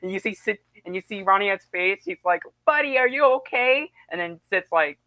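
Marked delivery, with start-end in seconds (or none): 2.7-3.9 lightly voiced worried pal, higher pitch; a hint of cartoon concern; concerned friend. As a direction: gently check on a friend.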